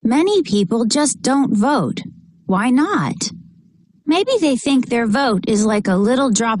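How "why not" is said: usual speaking voice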